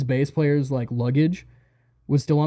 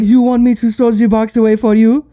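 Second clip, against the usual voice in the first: loud, raised-pitch mocking question; mock-exasperated repeat; 7 semitones higher, 9 dB louder than usual. Repeating himself at the tour manager, loud mock exasperation. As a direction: belt it out with exaggerated over-clarity.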